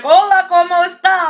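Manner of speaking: loud, high mock greeting; hammed-up Spanish hello; store greeter. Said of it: "the person greeting you at the door will be like".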